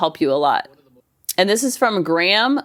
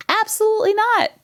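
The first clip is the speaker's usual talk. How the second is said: emphatic denial; 13 semitones higher than usual. Real, not acted. Host rejects having it figured out.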